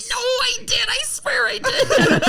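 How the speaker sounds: very high, slow, laughing squeaky protest; mock pleading. His pleading reply to her.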